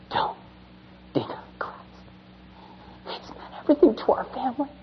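put-on soft, hushed child pleading; boy's whispered plea. Dying boy pleads to father.

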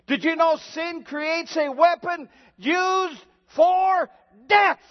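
shouted climax. Acted. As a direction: hurl the question at the congregation.

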